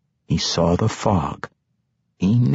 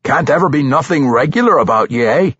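dwarf teasing; 7 semitones higher, 4 dB louder, faster than usual. Acted. Bruner teasing Regis.

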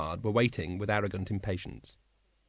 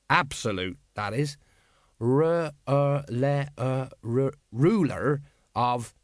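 halting reading; 2 semitones higher, slower than usual. A narrator's reading. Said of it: "he read slowly".

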